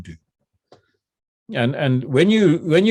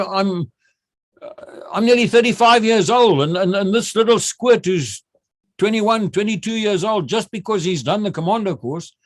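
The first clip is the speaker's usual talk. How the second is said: higher-pitched, mildly affronted retelling; indignant; 6 semitones higher than usual. Retold or imitated. Older captain resenting a young instructor ordering him about, mild indignation. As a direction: voice the wounded pride of an outranked captain.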